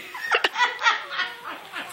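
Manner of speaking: sudden high burst of laughter that fades. Real, not acted. Filming the angry cat, owner bursts out laughing. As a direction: crack up at the cat.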